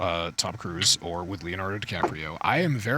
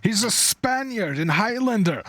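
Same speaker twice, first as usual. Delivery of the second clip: much higher, louder mocking rant; exaggerated high mocking voice; mock comedian rant; 14 semitones higher, 4 dB louder than usual. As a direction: squeal it out like a stand-up bit. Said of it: "a bit about Sean Connery".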